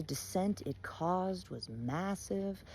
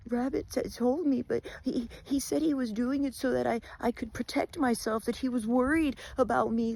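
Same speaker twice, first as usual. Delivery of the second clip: higher, stammering nervous answer; scared Wolf; 6 semitones higher than usual. Acted. Frightened Wolf stammering his excuse to Bear. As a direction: stumble out excuses in fear.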